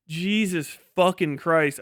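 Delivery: ordinary talk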